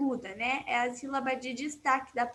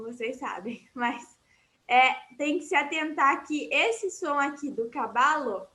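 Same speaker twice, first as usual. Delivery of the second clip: ordinary delivery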